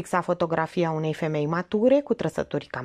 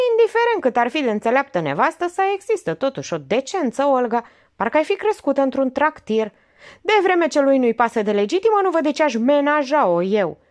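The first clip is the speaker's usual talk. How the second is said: higher, quicker heated exchange; bickering women; 8 semitones higher, 5 dB louder than usual. Acted. Aunt and niece quarrelling, higher and quick. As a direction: argue back hotly. Argument about decency.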